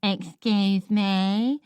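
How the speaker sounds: slow mocking echo of "Excuse me?", rising at the end; the tall boy parroting her back mockingly; mocking mimicry. A narrator's reading.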